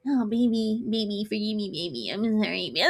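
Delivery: soft mumbled begging, mimicked; small, whiny mock plea; mock whispering. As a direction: imitate a man murmuring apologies. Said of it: "whispering in my goddamn camera".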